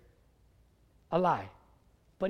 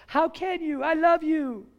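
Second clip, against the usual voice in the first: higher-pitched reproach, only hinting at a woman; pretend woman's voice; 7 semitones higher than usual. Delilah reproaches Samson for distrust, pretend woman's voice. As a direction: plead with the pitch raised. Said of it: "imagine I'm using the woman's voice".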